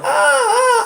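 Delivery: high mock shrieks, over and over; hammed-up repeated screams; repeated imitated screams. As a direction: keep shrieking like an overacting victim. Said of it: "And he just keeps going".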